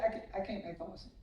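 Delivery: fast, soft mumbled excuse. Retold or imitated. Coach imitating Buffy's phone excuse, a fast soft mumble. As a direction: rush it out under your breath, sheepish.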